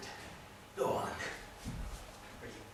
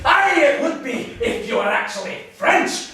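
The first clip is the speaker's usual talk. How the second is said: loud, high comeback; heated mocking comeback; shouted retort; 12 semitones higher, 9 dB louder than usual. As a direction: fire it back at a shout. Scotsman shouts back.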